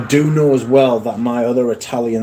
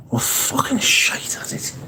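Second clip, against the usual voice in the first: muttered grumble; 13 semitones higher, 5 dB quieter, slower than usual. Real, not acted. Grumbling about English speakers.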